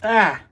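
loud high surprised yelp; sudden shocked cry; startled yelp. Real, not acted. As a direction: yelp as you get splattered. Arm sprayed with guts.

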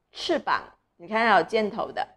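usual speaking voice

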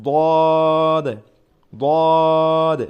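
long drawn-out heavy দদ, thick and loud; heavy Arabic letter. Shown as a demonstration. Teacher drawing out দদ thickly for students. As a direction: hold দদ long and heavy from the throat. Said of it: "একটু মোটা হবে".

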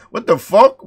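usual speaking voice

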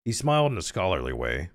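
ordinary delivery, nothing marked

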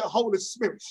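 normal talking voice

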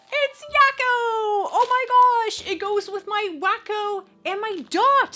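high, loud excited squeal; gasp, then squeal with delight at the gift; delighted surprise. Real, not acted.

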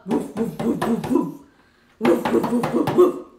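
cheerful loud dog barks; dog barking. Acted. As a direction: bark it out like a big dog. Dog sounds for child.

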